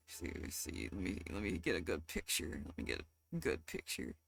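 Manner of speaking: very soft, low mutter; barely voiced, talking to yourself while busy; hushed self-talk. Real, not acted.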